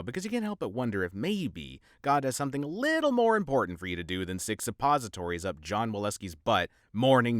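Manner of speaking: ordinary delivery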